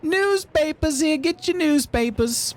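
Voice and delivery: high, drawn-out street hawker; slow, high newsboy call; paper boy. Acted. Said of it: "We got the paper boy".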